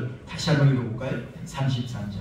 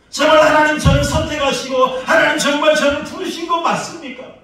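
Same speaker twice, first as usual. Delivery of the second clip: rising, louder, higher preaching up to a shout; full-force preaching; 10 semitones higher, 13 dB louder than usual. Acted. Pastor pressing the prophet's tears on the church in full-force preaching. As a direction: preach it to the rafters.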